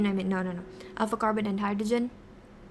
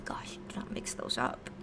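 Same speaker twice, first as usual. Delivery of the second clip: whispering; 7 dB quieter than usual. Real, not acted. Catching own mistake.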